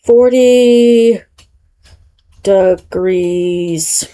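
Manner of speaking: drawn-out words with long pauses; slow, absent-minded self-dictation; sounding out slowly. Real, not acted. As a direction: stretch each word as if typing it in. Sounding out words while looking up conversion.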